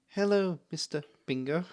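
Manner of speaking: put-on high, wary little-boy voice; a lightly voiced timid boy; nervous child greeting. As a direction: greet him while edging away. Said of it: "skitters away a little bit and goes".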